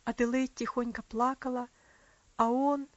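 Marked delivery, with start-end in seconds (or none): none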